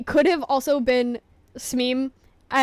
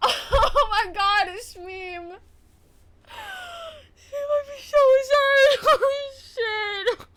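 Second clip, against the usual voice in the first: higher, louder laughing sobs; half laughing, half crying in panic; 11 semitones higher, 4 dB louder, slower than usual. Real, not acted. Streamer laugh-crying after accidentally banning Smeem. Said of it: "I'm gonna cry".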